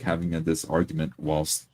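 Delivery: ordinary talk, nothing marked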